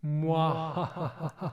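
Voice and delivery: gloating muah-ha cackle; evil villain laugh. Pretend. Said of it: "So that's my evil villain voice".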